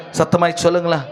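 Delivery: ordinary talk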